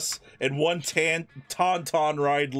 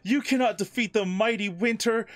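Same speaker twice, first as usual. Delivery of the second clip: ice queen villain; 8 semitones higher than usual. Acted. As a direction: sneer it down from a throne. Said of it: "says Elsa".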